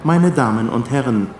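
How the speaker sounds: normal talking voice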